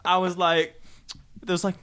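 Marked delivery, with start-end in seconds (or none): none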